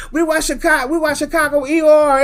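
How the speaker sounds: high-pitched comic outburst, breaking into a laugh; mock exclamation. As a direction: squeal it out as a joking reply. Mimicking TV viewers' reply.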